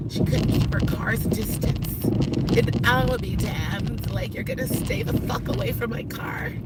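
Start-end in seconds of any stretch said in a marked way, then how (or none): 4.1-6.5 hissed under the breath; whispered warning; angry whisper. Real, not acted. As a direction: whisper it through your teeth, furious. Whispering rant in car.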